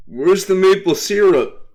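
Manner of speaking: loud, high mock Canadian accent with a tagged-on eh; attempt at Canadian accent. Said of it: "it was an attempt at a Canadian accent".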